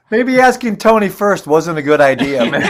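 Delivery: amused teasing. Real, not acted. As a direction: tease a bandmate while laughing. Teasing bandmate amid general laughter.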